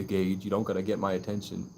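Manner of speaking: ordinary delivery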